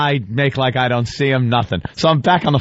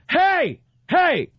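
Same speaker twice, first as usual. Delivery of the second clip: high calling out hey, hey; yelled hey. Retold or imitated. Cop calling after the fleeing car, high yell. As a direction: call out to stop the driver, way up high. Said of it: "he goes".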